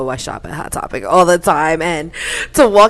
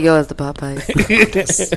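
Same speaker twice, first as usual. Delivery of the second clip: mock sick caller; much slower than usual. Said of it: "you gonna call out tomorrow like".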